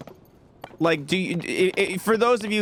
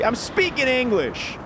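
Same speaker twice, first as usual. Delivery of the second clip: mock indignant; 10 semitones higher than usual. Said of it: "imagine how annoying people would be".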